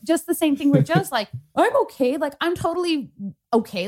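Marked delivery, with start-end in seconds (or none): 1.5-3.9 over-insistent, pitched up, acting out denial. Patient insisting to therapist she's recovered, exaggerated denial. Acts out insisting she was fine.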